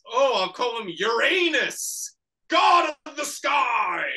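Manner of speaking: loud, high, drawn-out mock announcement; grandiose proclamation. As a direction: proclaim it slowly like a hammy mad scientist. Acting the scientist naming creation.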